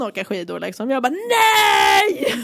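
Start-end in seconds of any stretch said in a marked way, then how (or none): 1.6-2.3 loud, high, drawn-out cry of no; screamed NO. Retold or imitated. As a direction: scream it in helpless horror. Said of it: "står verkligen och skriker". (utdraget skrikande nej)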